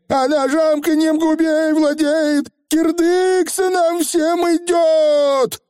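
высокий вой обречённого коротышки; провой предсказание гибели, растягивая конец; паника. A narrator's reading. Фигль в панике предрекает гибель. (панический вой)

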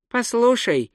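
high-pitched, patient tourist voice; mildly patient, high character voice; patient explaining. A narrator's reading. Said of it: "терпеливо сказал турист".

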